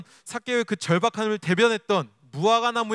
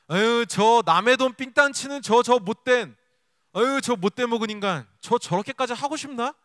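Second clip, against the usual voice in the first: scornful crowd; 3 semitones higher, 5 dB louder than usual. Acted. Onlookers jeering at rich Zacchaeus up the tree, scornfully. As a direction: act out onlookers sneering at a cheat. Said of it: "비웃었을 겁니다".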